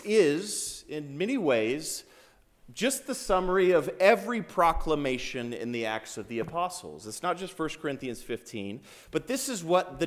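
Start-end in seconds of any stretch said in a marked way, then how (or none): none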